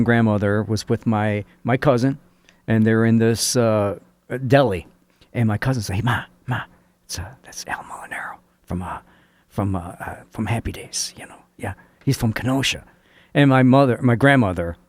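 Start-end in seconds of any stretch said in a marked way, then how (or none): 6.0-12.8 soft, confidential murmur with halting pauses; hushed aside. Retold or imitated. Cousin discreetly pointing out a TV actor to Ma, hushed. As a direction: point someone out under your breath, as if retold.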